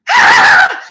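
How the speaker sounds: sudden high laughing shriek of fright; panicked scream. Retold or imitated. Retelling the spider scare, a laughing scream.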